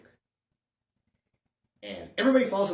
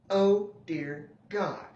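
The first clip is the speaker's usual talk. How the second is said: slow, drawn-out dismay; as if the cartoon pains him; horrified groan; 4 semitones higher, much slower than usual.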